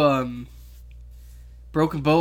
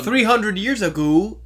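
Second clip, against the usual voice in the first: higher, slow, exaggerated Canadian imitation; hammed-up Canadian echo, louder and drawn out; mock Canadian accent; 4 semitones higher, 5 dB louder, slower than usual. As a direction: stretch it out in teasing Canadian. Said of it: "in a Canadian accent".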